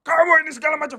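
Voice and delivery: high, loud nagging scold; scolding parent. Retold or imitated. Preacher mocking a scolding parent, high loud nag. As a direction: scold the child in a shrill mocking tone. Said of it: "nggak pernah tuh kayak begitu".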